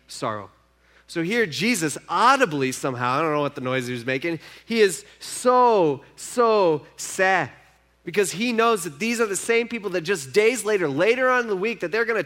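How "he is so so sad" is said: drawn-out, louder SO, SO SAD; emphatic so sad. Acted. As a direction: stretch out "so, so sad" slowly and loudly. Preacher stresses Jesus' sorrow.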